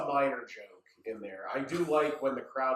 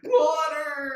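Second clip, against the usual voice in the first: high, loud, strained plea for water; gasping shout for water; desperate cry; 12 semitones higher, 6 dB louder than usual. Retold or imitated.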